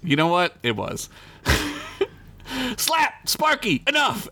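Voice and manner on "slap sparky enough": high exasperated yell; high scolding yell; yelled back. Acted. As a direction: bark names at the goblins. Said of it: "Axwood yelled back".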